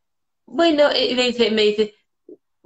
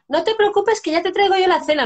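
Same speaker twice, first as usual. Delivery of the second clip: put-on much higher, childlike and reassuring; sweet child voice; 9 semitones higher than usual. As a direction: voice a little kid offering to help. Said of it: "Me dicen". (voz de niña dulce y mucho más aguda)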